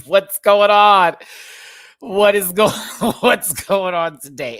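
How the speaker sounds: loud, high, drawn-out cry breaking into laughter; hysterical disbelief. Retold or imitated. Retelling howling at Hannah's unhinged act, laughing disbelief. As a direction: howl it in amused bewilderment.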